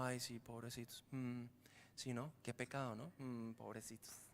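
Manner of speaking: hammed-up pity from fake mourners; mock fake pity. Preacher mocks fake pity. (lástima fingida, suave y grave)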